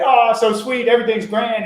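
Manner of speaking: high, loud sing-song mock cheer; mock sweet girl. Uncle mocking the hoped-for happy reaction, high and sugary. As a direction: ham up a sugary, delighted girl. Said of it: "smile and say".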